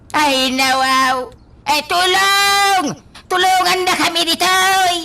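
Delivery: high, loud desperate cries; trapped father calling for rescue, shouting; shouting for help. Acted. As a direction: yell for rescue.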